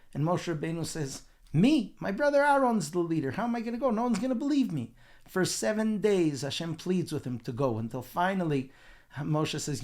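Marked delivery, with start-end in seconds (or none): 1.5-5.0 high, loud-starting objection; incredulous protest. Retold or imitated. Moshe objects to God sending him, incredulous protest. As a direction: protest in disbelief, pitched well up.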